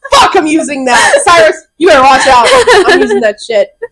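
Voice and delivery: loud, laughing through the words; laughing shout. Real, not acted. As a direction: crack up while you say it. Cracking up at the joke.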